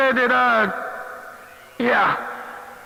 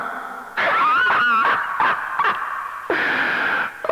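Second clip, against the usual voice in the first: high, breathy howl of laughter; laughing hard at the other operator. Real, not acted. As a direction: burst out in a wild laugh. Cackling at his own insult.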